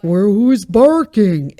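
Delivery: slow, loud, mock-oblivious where who's barking; mock clueless owner. Mocking a clueless owner beside her barking dog, slow dopey voice. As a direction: play the owner who hears nothing.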